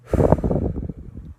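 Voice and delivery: long weary unvoiced sigh; drained sigh before speaking; heavy sigh. Real, not acted. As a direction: let out a heavy exhausted breath. Venting after hospital ordeal.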